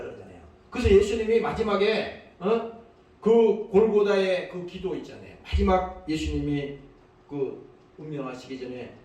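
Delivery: usual speaking voice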